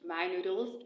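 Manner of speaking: normal talking voice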